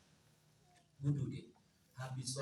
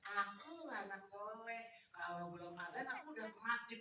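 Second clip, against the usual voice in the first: lightly voiced child; 3 semitones lower, 10 dB quieter than usual. Caca boasting she is pious.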